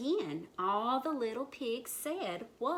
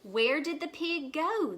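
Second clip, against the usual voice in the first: higher, louder puzzled outcry; alarmed cry; 8 semitones higher, 6 dB louder than usual. A narrator's reading. Animals find the pig gone, higher loud cry. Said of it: "the animals cried".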